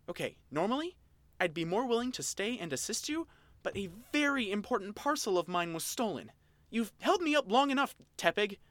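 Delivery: put-on fast, rising impatient boyish voice; impatient Oshawott. Oshawott hurrying off to find his stolen parcel, fast and impatient.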